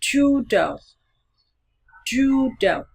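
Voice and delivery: "Judo" with long first vowel, dropping on the last syllable; long U drill. Shown as a demonstration. Teacher modelling a practice word, "Judo" slow and clear. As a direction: stretch the first syllable of "Judo", then drop. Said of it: "chúng ta có từ".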